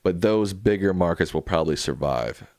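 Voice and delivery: ordinary talk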